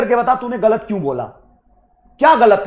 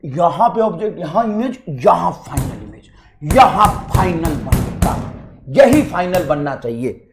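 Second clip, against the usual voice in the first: slow, lower mock recitation; mocking rote learner; 6 semitones lower than usual. Teacher imitating a student's memorised reasoning, slow and mocking. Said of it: "देखो ऐसे दिमाग में biology लेके बैठता".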